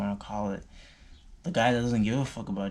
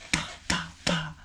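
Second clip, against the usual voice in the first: mock punch sounds; 6 semitones higher than usual. Imitating fighting blows.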